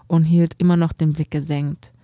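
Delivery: plain reading voice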